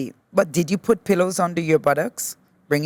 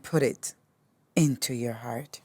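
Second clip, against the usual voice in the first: hushed whisper, softer and lower; 5 semitones lower, 6 dB quieter than usual. Real, not acted. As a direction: breathe the words out into a whisper. Closing gesture in yoga flow.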